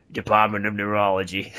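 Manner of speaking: mock official voice. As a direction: drop deep for a comic department announcement. Joking re-read of the title, then laughter.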